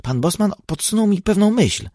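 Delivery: put-on high, excited boyish call; eager exclaim. A narrator's reading. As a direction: burst out with a sudden idea. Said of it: "zawołał".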